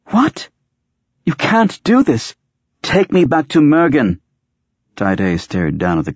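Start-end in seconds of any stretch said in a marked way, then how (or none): 0.1-4.2 very high-pitched, distressed woman character; high, insistent demand to be taken back; alarmed protest. A narrator's reading. Sari protests being taken away.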